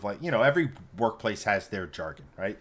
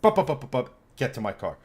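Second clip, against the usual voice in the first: fuming. Retold or imitated. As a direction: sputter with rage, then fade out. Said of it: "I am pissed".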